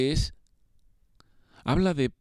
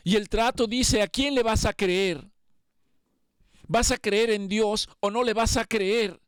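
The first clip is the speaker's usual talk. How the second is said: higher and driving, urgent sermon voice; fervent preaching; 6 semitones higher than usual. Acted. The preacher challenging his congregation to trust God, fervent preaching. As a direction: press the challenge home to the congregation.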